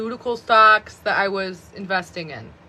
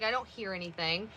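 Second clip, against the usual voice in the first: librarian's reply; 15 dB quieter than usual. Retold or imitated. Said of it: "the librarian would be like".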